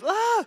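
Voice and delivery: a sharp yelp of panic; shrill alarmed shriek. Retold or imitated. Wife shrieking at a deer from the passenger seat. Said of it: "it would be this".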